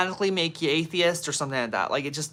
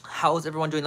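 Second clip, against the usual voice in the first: ordinary talk, nothing marked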